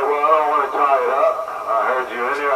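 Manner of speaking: usual speaking voice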